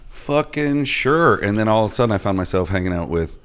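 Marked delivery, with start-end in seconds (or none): 0.1-1.4 drawn-out, high-pitched acceptance; slow, emphatic retold yes; eager yes. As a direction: stretch it out slowly and high, mock-eager. Retelling eager acceptance of an invitation.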